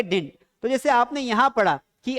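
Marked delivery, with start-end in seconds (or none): 0.0-0.5 didn't as a one-beat "dint"; correct pronunciation. Shown as a demonstration. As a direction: answer with didn't squeezed to "dint". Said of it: "क्या पढ़ेंगे".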